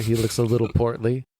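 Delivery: normal talking voice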